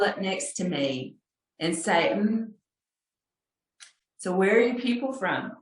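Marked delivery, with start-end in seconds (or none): 2.1-5.5 a rough, higher pretend Irish accent. Irish local asking a visitor where she's from, in a mock Irish accent. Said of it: "with an Irish accent that I can't even do".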